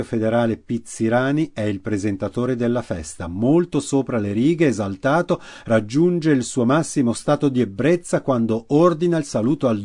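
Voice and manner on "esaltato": plain reading voice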